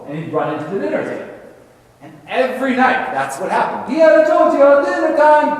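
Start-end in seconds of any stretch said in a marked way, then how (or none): 3.9-5.6 high, loud mock-Italian mama calling out; terrible Italian accent. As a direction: holler it in a hammed-up Italian accent. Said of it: "here's my terrible Italian accent".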